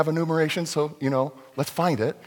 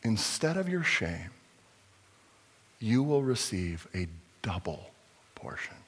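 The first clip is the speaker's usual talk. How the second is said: fading to a whisper on portion; hushed reading; 4 semitones lower, 4 dB quieter than usual. Real, not acted. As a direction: let it drop to a hush.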